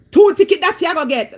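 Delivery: loud, fast, high mock threat; mimicked officer's threat. Officer threatening the Canadian driver with tickets, loud mock threat. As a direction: fire it off as a loud officer imitation.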